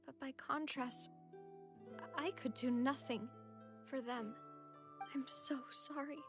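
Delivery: soft, faltering apology; guilt-ridden sorrow. Acted. Mourner who could do nothing for her friends, sorrowful. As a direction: let it sink to a soft, halting regret.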